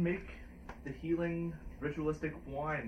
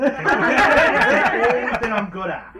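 belted through laughter, much higher and louder, slow; loud laughing wail; 8 semitones higher, 15 dB louder, slower than usual. Acted. Winemaker wails his one talent, laughing hard.

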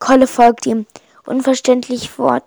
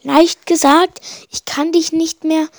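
whining; 6 semitones higher, 4 dB louder than usual. A narrator's reading. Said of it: "jammert Kolle".

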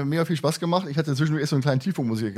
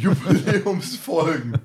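laughing; 4 semitones higher, much slower than usual. Real, not acted. Mocking repeat of friend's word.

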